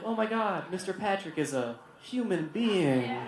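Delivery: soft, slow mock awe; hushed student disbelief, drawn out; hushed astonishment. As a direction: say it under your voice, amazed. Student spotting a teacher outside.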